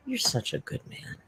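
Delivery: soft whispered praise. Real, not acted. Streamer whispering praise to her cat.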